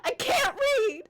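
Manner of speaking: a small goblin wailing his confession; hint of a whiny goblin. Goblin telling the captain he can't read, whiny.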